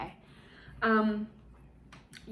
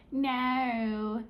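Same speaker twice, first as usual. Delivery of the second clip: drawn-out, badly faked Aussie vowel; Australian accent; 3 semitones higher than usual. Shown as a demonstration. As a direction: stretch it in an amateur Australian accent. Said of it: "Australian accent from H two O".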